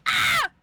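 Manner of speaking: sudden high loud yelp; startled yell. Retold or imitated. Caught watching anime when someone walks in, a high startled yelp.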